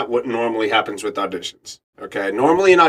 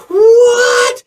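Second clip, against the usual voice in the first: high, loud, cracking what; shocked shout; 7 dB louder than usual. Retold or imitated. Hearing he booked the role, shocked crying shout. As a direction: scream it in disbelief.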